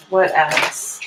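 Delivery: ordinary talk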